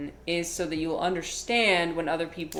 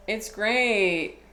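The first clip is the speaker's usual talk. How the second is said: higher-pitched, insincere-sounding praise; unintentionally sarcastic; 4 semitones higher than usual. Retold or imitated. Student trying to sound enthusiastic, coming across as sarcastic. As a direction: imitate a failed try at enthusiasm. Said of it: "it came across as extremely sarcastic".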